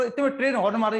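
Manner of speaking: ordinary delivery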